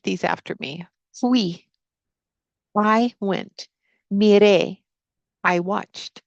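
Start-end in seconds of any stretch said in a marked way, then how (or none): none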